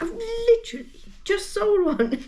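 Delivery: high, exasperated squeak; a comic wail of disbelief at bad timing; high mock dismay at having just sold one; mock exasperation. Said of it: "I'm like".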